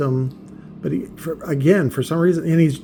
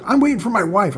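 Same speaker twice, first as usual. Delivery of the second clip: higher-pitched mock back talk; mouthing off; 6 semitones higher, 4 dB louder than usual. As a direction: imitate someone talking back, pitched up. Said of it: "he fucking mouths off to me".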